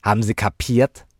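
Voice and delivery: loud, high knowing question; sly. A narrator's reading. Child checking with the widow, loud and sly. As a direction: ask pointedly as a sly child. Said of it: "fragte Sazie schlau zur Witwe".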